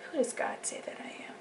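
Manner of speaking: quick, high whisper; urgent whisper. Retold or imitated. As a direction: whisper it fast like a realisation. Whispered question of faith.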